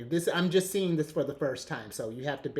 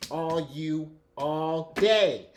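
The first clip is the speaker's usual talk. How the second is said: slow, stretched, loud and forceful; aggressive shout; 3 semitones higher, 8 dB louder, much slower than usual. Real, not acted. Reader telling the querent it is all theirs, loud and aggressive. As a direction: hammer each word out at the listener. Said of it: "Sorry, that was aggressive".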